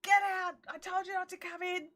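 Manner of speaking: snapping get out at the dog, retold; retold yell. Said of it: "I'm yelling at him".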